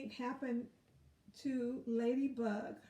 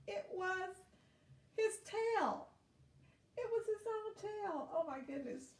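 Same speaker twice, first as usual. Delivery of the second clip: much higher, playful reveal; amused surprise; 9 semitones higher than usual. Acted. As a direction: reveal the silly twist with glee.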